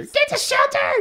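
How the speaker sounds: very high shrieking warning; screeching. Acted. Watcher shrieking a warning again, laughing. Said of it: "screeched Watcher".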